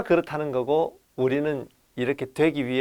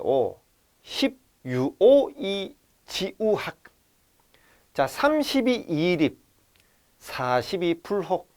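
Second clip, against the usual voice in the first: put-on slow, measured recitation of old Chinese text; classical text recitation; 3 dB quieter, slower than usual. Shown as a demonstration. Teacher reciting the Analects original to students, slow and measured. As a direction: chant the original line by line, slowly.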